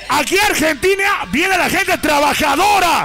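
MC hype call. Acted. MC rousing the crowd, building shout. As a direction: build to manos arriba.

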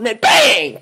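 sudden mock gunshot shout; mock bang shout. Mock shooting story.

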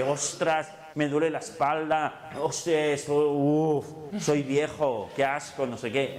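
hammed-up moaning about aches; mock complaining. Complaining to himself all day, whiny and loud. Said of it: "Si estás todo el día diciéndote".

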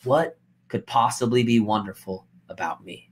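defeated, quiet self-pity; self-doubting. Shown as a demonstration. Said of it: "do you hear the difference in my expression".

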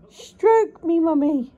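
high, loud demanding cat voice; mock whiny cat. Owner imitating the cat's demands, high and loud whiny. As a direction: whine like a needy cat. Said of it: "you go".

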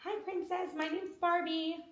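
high, soft doll voice; Barbie. Pretend. Barbie meeting Princess, high soft play voice. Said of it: "Mommy can't do that voice".

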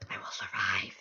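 low hushed whisper of a vow. Real, not acted. Player vows to survive, whispering. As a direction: promise it to yourself under your breath.

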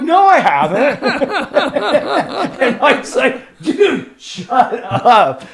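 high, loud quotes broken by laughter; laughing retort. Retold or imitated. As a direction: crack up while quoting the fight. Retold quarrel, laughing.

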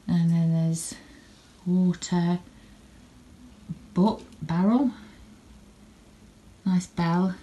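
ordinary talk, nothing marked

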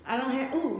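talking around a lollipop; words round a lollipop in the mouth; sucking a lollipop. Real, not acted. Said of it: "I'm sucking on a lollipop".

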